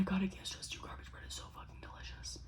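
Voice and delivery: a reverent whisper about the food; dramatic whisper. Real, not acted. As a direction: confide it like a delicious secret. Raving about garbage bread.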